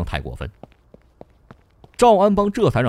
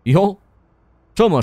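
mock-surprised opening, then pointed taunt; sarcastic; 5 semitones higher, 5 dB louder than usual. A narrator's reading. Governor taunting the businessman about prison, sarcastic. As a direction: needle him with light mockery. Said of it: "赵安邦讥讽道".

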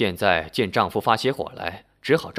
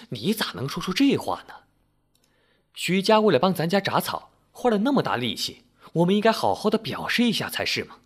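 higher, fast indignant protest; indignant wife; 7 semitones higher than usual. A narrator's reading. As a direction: push back at him, fast and high. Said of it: "气呼呼地说".

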